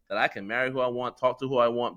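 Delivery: ordinary talk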